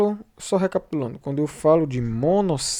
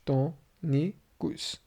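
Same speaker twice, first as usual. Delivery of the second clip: tônicos softly counted out as tô, ni, cos; very slow, hushed tônicos in three pieces; syllable by syllable; 7 dB quieter, much slower than usual. Shown as a demonstration. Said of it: "eu tenho três sílabas".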